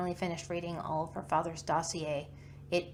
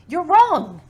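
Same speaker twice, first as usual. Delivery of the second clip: sudden furious shout, much higher and louder; angry outburst; 11 semitones higher, 18 dB louder than usual. Acted. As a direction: she loses her composure and yells. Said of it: "she just broke paint".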